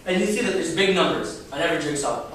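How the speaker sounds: normal talking voice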